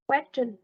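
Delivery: "question" stressed on "ques"; stress on first syllable. Shown as a demonstration. Teacher giving an example for students, "question" stressed on its first syllable. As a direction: hit the opening syllable of "question". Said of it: "on the first is quest".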